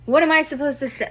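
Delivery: real exasperation breaking through; stressed out. Real, not acted. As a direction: throw it out, overwhelmed. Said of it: "this is stressing me out".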